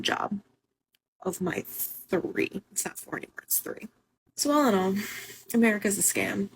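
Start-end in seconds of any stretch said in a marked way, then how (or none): 2.7-3.9 barely voiced aside, much softer; under-breath correction. Real, not acted. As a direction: mutter the correction to yourself.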